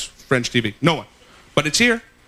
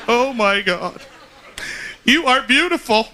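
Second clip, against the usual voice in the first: higher, hammed-up Oprah weeping; mock Oprah crying; 7 semitones higher, slower than usual. Oprah gushing to a guest, mock tearful. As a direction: gush and cry it out like Oprah. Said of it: "Oprah begins to cry like only Oprah can".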